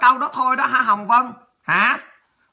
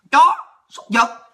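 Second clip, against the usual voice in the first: slow, loud spat-out insult; loud, drawn-out contempt; angry insult; 4 semitones higher, 6 dB louder, slower than usual. Real, not acted. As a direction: spit the words out slowly. Insulting a commenter.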